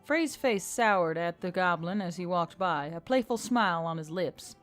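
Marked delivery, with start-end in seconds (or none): none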